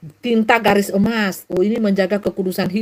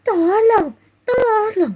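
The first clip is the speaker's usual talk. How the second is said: high, drawn-out mock call; half-hearted cry for help; 12 semitones higher, much slower than usual. Shown as a demonstration. Preacher shows how a real victim would not shout, mock calling. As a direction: stretch out an unconvincing plea, pitched high. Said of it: "Bukan teriaknya".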